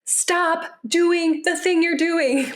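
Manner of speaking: high, slow, drawn-out scolding; a parent losing it, hammed-up; mock angry parent. Parent who has lost it snaps at a frozen child, slow and high. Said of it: "when you've lost it with a kid".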